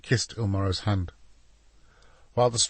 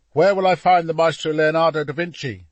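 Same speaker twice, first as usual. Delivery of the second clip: louder, higher, official demand; commanding young captain; 7 semitones higher, 7 dB louder than usual. A narrator's reading. Captain marching in to find the painter, commanding. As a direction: ask it loud for the room.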